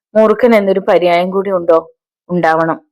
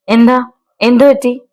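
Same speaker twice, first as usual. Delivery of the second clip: anxious alarm; 5 semitones higher than usual. A narrator's reading. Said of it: "അവന് വെപ്രാളത്തോടെ".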